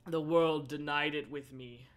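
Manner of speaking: ordinary delivery, nothing marked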